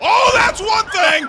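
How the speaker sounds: high, loud, drawn-out shout of amazement; stretched-out, high, loud commentator yell; excited shout. Real, not acted. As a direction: yell it like the move just floored you. Shocked reaction to a big move.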